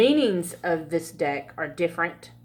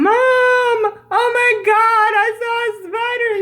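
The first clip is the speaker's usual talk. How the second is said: hollering at mom; 6 dB louder than usual. Retold or imitated. Daughter yelling to her mom about a tiny spider, high sing-song wail. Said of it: "I'm hollering at my mom".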